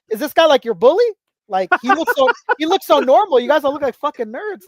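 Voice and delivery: mocking roast. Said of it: "starts roasting the shit out of all of us".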